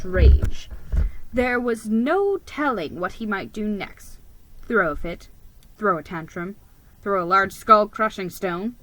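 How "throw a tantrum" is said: ordinary delivery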